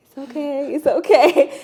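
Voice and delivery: laughing, soothing "it's okay"; pat-on-the-back comfort; playful mock soothing; comforting cousin. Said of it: "She was like".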